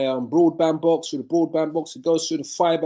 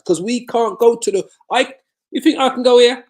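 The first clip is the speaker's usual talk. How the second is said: you can't be serious, said loud and high; scoffing at the very idea; incredulous challenge; 8 semitones higher, 7 dB louder than usual. Real, not acted. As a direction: pitch jumps high and loud in disbelief. Mocking idea of preaching in Haiti.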